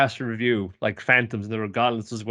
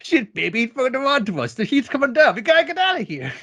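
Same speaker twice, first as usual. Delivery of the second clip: much higher, frantic fleeing-crook voice; panicked coward; 13 semitones higher, 3 dB louder than usual. Acted. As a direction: squeal it like a cornered crook who wants out now. Crime boss panics on phone.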